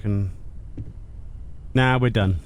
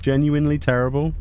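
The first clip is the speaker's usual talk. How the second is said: ordinary talk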